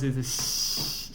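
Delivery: xi hissed softly and slowly; soft xi sound. Shown as a demonstration. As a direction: breathe out xi without voice. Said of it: "xi sound".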